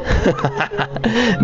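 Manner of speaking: high burst of laughter; amused laugh. Real, not acted. Amused by flirty accent message.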